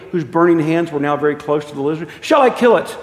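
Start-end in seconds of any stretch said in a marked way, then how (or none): 2.2-3.0 high, loud pressing question; acted angel demanding permission; urgent demand. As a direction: press for an answer in a raised voice. Angel demands leave to kill.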